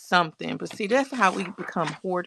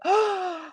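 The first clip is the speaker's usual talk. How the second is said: sharp surprised gasp; 10 semitones higher than usual. Real, not acted. Reacting to a viewer's message.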